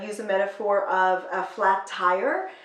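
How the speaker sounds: normal talking voice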